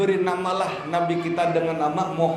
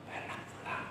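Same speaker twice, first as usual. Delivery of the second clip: "Benak pulang." breathed as a soft unvoiced whisper; 21 dB quieter than usual. Shown as a demonstration. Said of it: "Volume suara kan mempengaruhi".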